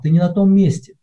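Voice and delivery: ordinary talk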